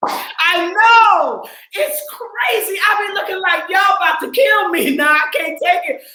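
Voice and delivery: so proud it spills out; overjoyed. Real, not acted. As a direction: gush about them, laughing. Overwhelmed by students' success.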